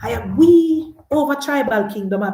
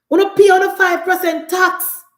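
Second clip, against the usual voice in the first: higher and louder, jeering; mocking shout; 7 semitones higher, 7 dB louder than usual. Real, not acted. Taunting diaspora voters about the tax, loud jeering shout. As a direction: throw it at them like a taunt.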